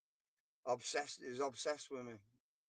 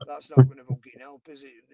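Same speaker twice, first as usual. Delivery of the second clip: imitating dad kicking off, loud outbursts; a retold dad ranting, spiking loud on help; 2 semitones higher, 14 dB louder than usual. Dad kicking off at his son, mock angry rant. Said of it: "my dad was kicking off at me".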